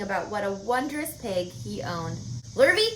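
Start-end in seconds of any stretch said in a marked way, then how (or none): 2.6-2.9 loud high call across the barn. A narrator's reading. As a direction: call the hired man from afar. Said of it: "he called".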